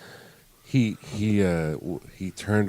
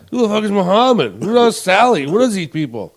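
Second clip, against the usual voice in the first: loud, high-pitched baffled yell; indignant blurting, high and loud; imitated yelling; 10 semitones higher, 7 dB louder than usual. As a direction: yell it at someone sitting right beside you. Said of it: "I'm still gonna yell at you anyway".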